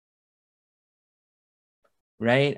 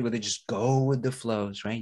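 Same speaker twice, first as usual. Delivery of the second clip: normal talking voice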